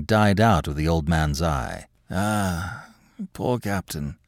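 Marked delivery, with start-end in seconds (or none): 2.1-4.2 soft, regretful sigh of words; hushed, fond regret; wistful. Acted. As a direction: let the voice drop into quiet mourning. Old man softens, mourning his dog.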